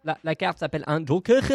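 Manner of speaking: mock German accent on "Joker". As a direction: redo it as a caricature German. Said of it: "le dire avec l'accent allemand". (accent allemand parodique)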